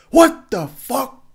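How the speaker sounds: loud high slow "What the fuck?"; mock outraged yell. Ranting at bad movie scenes, a loud drawn-out outburst. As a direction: yell it like a baffled viewer. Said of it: "It's gonna be me going".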